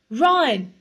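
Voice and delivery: loud, high call; shouting. A narrator's reading. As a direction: shout across the rockfall. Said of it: "he shouted".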